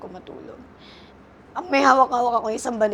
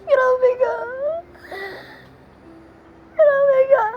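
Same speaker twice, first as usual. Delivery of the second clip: much higher and louder, real sobs breaking through; sobbing; 5 dB louder, slower than usual. Real, not acted. Mother crying out at the partner who mocked her pain, sobbing. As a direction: cry the words out through tears.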